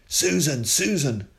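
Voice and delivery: loud, high call across the yard; father shouting for Susan from the gate. A narrator's reading. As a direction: call her name from the gate. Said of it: "shouted Tom from the gate".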